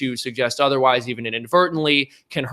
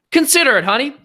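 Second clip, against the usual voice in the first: camp it up, teasing; sassy; 10 semitones higher, 5 dB louder than usual. Real, not acted. Sassy sign-off on merch pitch.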